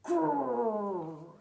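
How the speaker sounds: low growl through the teeth; mock grumpy growl.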